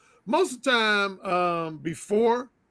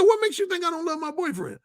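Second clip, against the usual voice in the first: higher-pitched imitation of her protest; serious challenge; 8 semitones higher than usual. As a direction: challenge him earnestly, voicing the woman. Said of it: "she got real serious".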